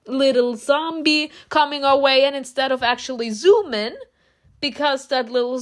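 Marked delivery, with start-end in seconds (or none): none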